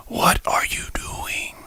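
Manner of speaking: slow, breathless whispered disbelief. Real, not acted. Vlogger stunned by a gunman, whispering slowly.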